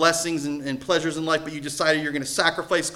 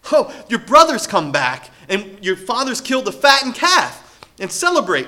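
bursting to share the good news; excited servant; 7 semitones higher, 9 dB louder than usual. Acted. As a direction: servant blurting the news with a laugh.

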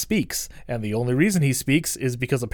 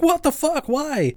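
high, hammed-up "what the fuck"; mock shocked outburst; 13 semitones higher, slower than usual. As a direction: squeal it out in mock disbelief. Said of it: "he's like".

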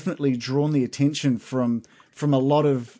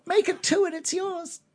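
shopkeeper voice. Acted. Merchant haggling with adventurers over a pen, high put-on shopkeeper voice. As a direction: play the sly merchant squeezing a customer.

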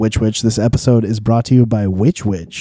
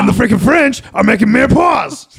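higher, louder mock rant; mock shout; 10 semitones higher, 4 dB louder than usual. Mock ranting about French cooks.

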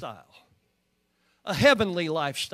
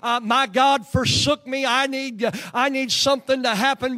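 full-force revival preaching; rousing, insistent preacher's cadence; 5 semitones higher, 9 dB louder than usual. Real, not acted. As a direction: drive it home to the congregation, raised and unrelenting.

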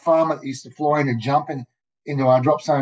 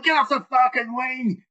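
high-pitched mock yell at the jumpers; retold yell; 10 semitones higher than usual. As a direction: bark it like a frantic pilot through the window. Pilot yelling at climbing jumpers.

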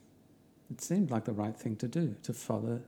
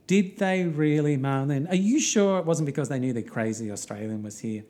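mock disbelief; 4 semitones higher, 7 dB louder than usual. Narrator to Marlene about the Polish women, mock disbelief. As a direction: press the question loudly, half-joking.

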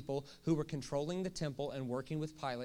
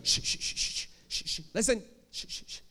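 mock shushing; 3 semitones higher than usual. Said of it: "they'll go, they'll go".

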